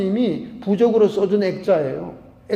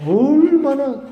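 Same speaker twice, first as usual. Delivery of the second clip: high, loud cry; mock shout; 7 semitones higher, 6 dB louder, much slower than usual. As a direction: let it ring out loud. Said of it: "소리를 지르면서".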